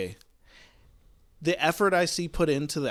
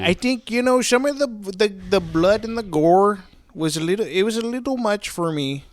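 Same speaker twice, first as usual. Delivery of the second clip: higher, louder hammed-up Canadian accent; 7 semitones higher, 6 dB louder than usual. Bottle weighing in on the gore in Canadian accent. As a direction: lay on the Canadian accent thick. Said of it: "in a Canadian accent".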